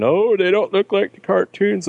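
high-pitched mock whining; mocking complainer. Fan mimicking people who complain about the movies, mocking whine. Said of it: "all the people bitching and complaining".

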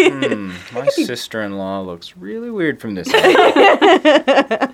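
very high, slow mock musing. Joking about doing the syringe, high amused musing. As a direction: muse slowly in a high voice. Jokey acted thought.

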